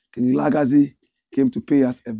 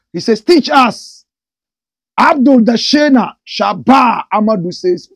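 loud, high hyped call; excited reading; 7 semitones higher, 10 dB louder than usual. Real, not acted. Reading out viewers' comments on the live, excited. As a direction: punch each name like a host.

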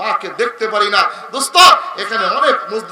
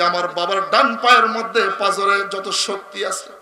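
melodic preaching; 7 dB louder than usual. Real, not acted. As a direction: chant the story, swelling into loud cries. Preacher tells story in melodic chant. (সুরেলা ওয়াজের টানা গলা)

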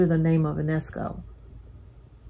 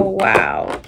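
ordinary talk, nothing marked

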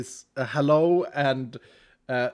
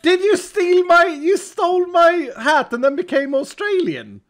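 high, loud incredulous accusation; mock outrage; 13 semitones higher, 7 dB louder than usual. Real, not acted. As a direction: accuse them in loud, playful disbelief. Mock accusation over stolen hat.

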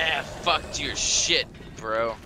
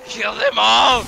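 battle cry; 7 semitones higher, 9 dB louder, slower than usual. Acted.

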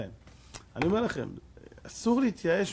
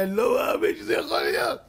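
much higher, louder disbelief; can't believe his eyes; imitating stunned amazement; astonished; 8 semitones higher, 4 dB louder than usual. Shabtai sees the student teaching.

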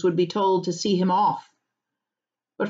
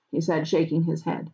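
usual speaking voice

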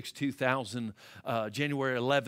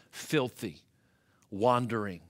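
normal talking voice

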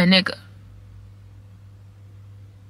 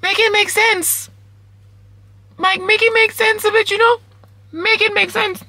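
incredulous; 12 semitones higher than usual. Real, not acted. Overwhelmed by good news.

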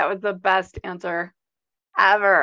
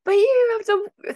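high, louder insistent plea; mock pleading; 13 semitones higher, 6 dB louder than usual. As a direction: beg him to wake up, hammed-up. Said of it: "I was like".

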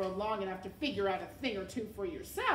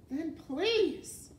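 higher, stretched-out "please"; exasperated plea; 7 semitones higher, 4 dB louder than usual. A narrator's reading. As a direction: plead with strained patience. Exasperated rat replies to shouting.

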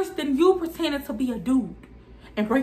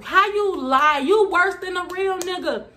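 outraged; 6 semitones higher than usual. Real, not acted. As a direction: yell the question at her. Outraged at the lie.

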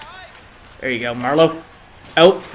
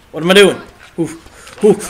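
frustrated exclamation; 2 semitones higher, faster than usual. Real, not acted. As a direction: throw the question up louder. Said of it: "repeated louder".

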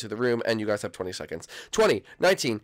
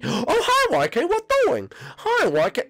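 much higher, louder singsong greeting; creepy animatronic greeting; 4 dB louder than usual. Acted. Animatronic hunter greeting a player, high and singsong. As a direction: greet the victim like a cheerful killer toy.

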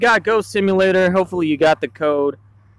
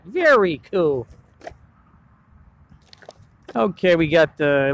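normal talking voice

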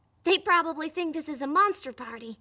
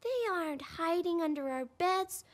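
soft, slow, hushed realization; hushed wonder; 3 semitones lower, 10 dB quieter than usual. Acted. Host telling the child a secret idea, hushed wonder. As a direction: lean in and share a secret.

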